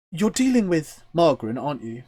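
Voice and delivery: worried innkeeper checking who she's talking to; concerned wood elf. Acted. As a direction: ask it high and concerned. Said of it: "a very concerned look from the wood elf".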